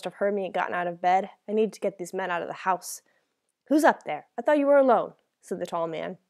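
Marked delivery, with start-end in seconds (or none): 3.7-5.1 raised, higher-pitched suspicion; suspicious challenge. A narrator's reading. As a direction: confront the captive, demanding an explanation. Robber suspiciously challenges captive.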